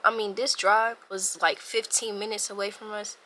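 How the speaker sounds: ordinary delivery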